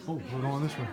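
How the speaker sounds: ordinary delivery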